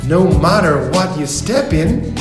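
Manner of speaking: ordinary delivery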